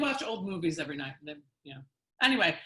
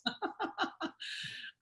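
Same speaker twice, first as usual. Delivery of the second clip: laugh; 14 semitones higher, 5 dB quieter than usual. Real, not acted. Laughing at his joke.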